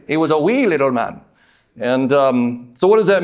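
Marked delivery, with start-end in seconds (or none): 0.1-1.2 lightly put-on Irish accent; a hint of Irish lilt on "wee little man". Preacher joking about a short Zacchaeus, light mock Irish accent. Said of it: "say it in an Irish accent".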